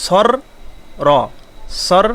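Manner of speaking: "সর" and "র" broken apart slowly; syllables split. Shown as a demonstration. Teacher breaking the word up to show doubling. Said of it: "রটা কিন্তু দুইবার হলো".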